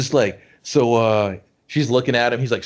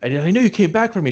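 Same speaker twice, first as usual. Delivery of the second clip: fast, higher, breathless gush; mock swoon; 6 semitones higher, faster than usual. She gushes to Toby, fast giddy mockery. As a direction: rush it like a giddy lover.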